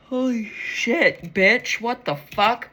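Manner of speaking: loud shocked exclamation; surprised outburst. Real, not acted. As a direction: blurt it out in disbelief. Shocked by card pulled.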